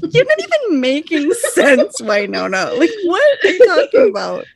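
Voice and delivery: high, loud, laughing disbelief; cracking up while mocking her; incredulous laughing rant. Mocking Wynonna's cat rant.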